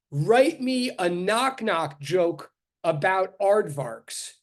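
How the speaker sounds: very slow, deliberate prompt; slow dictation. Retold or imitated. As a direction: spell it out slowly. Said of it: "I just said to Chat G P T".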